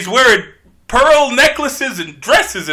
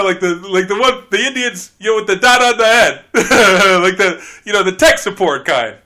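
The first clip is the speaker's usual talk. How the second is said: normal talking voice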